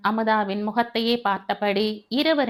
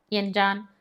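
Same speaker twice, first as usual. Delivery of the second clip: usual speaking voice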